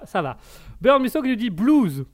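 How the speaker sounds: normal talking voice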